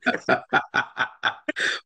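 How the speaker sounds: long, rolling belly laugh at a funny story; real amused laughter; hearty laugh. Real, not acted. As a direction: burst out laughing. Reacts to awkward hug story.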